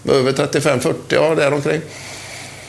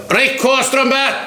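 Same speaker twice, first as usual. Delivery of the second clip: shouted order; 10 semitones higher, slower than usual. Retold or imitated. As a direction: yell at him to hold his spot. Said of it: "och så ropar Wernersson".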